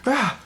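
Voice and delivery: high-pitched mock leaping cry; acted yelp while jumping; short yell. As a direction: let out a quick high "Ah!". Acting out jumping off cart.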